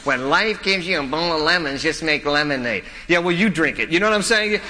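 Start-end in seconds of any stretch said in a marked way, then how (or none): 0.0-2.8 mocking recital of a cheesy slogan; lightly mocking, hammed-up cliche. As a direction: parrot it like a corny platitude. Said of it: "That is just the cheesiest cliche".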